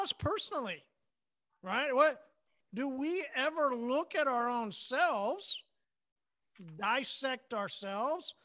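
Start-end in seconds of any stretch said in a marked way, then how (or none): none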